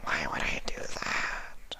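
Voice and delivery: slow breathy alien whisper; hushed low creature voice; whispering changeling. Acted.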